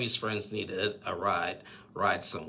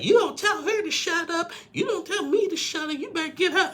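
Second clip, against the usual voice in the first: very high, loud mock yelling; shrieky-pitched acted scolding; retold friend going off; 6 dB louder than usual. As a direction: imitate an outraged friend telling someone off. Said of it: "the friend went off".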